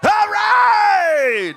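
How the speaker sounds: high, ringing hype shout; excited shout. Real, not acted. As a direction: yell it to fire up the arena. Emcee hyping robotics crowd.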